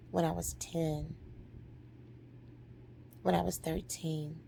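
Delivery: soft, low, halting admission; solemn confession. Retold or imitated. Daughter forgiving mother for childhood hurts, hushed and solemn. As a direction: lay out each age quietly with long pauses.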